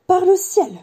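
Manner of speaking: high, loud gasp of recognition in words; awed old barman. A narrator's reading. Barman recognising a famous visitor in his bar, awed exclamation. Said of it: "murmura le vieux barman".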